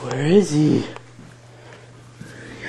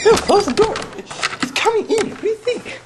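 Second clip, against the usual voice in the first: panicked. Real, not acted. Panicked pleading.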